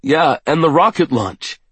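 high, eager voice; excited. A narrator's reading. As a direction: jump in eagerly. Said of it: "his face lighting up".

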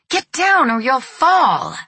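high, loud, drawn-out frightened shout; terrified yell. A narrator's reading. Mother to son up on the parapet, shrieking with terror. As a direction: shriek a desperate warning, slow and high. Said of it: "his mother shrieked with terror, yelling".

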